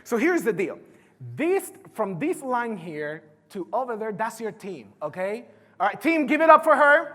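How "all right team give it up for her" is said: loud rally cry to the room; hype the crowd. Real, not acted. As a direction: pump the audience up. Hyping audience for volunteer.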